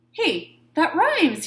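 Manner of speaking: high, slow delighted cry; excited cry. A narrator's reading. Shark delighted at finding a rhyme. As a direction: discover it with glee. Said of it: "he cried".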